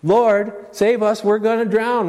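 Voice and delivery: very high and loud desperate cry; frightened disciple crying out to the Lord; panicked plea. Retold or imitated. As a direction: scream for rescue in terror. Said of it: "One saying".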